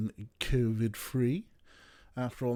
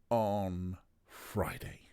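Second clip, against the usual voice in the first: whispered; 2 semitones lower, much slower than usual. Real, not acted. Drops to whisper mid-sentence.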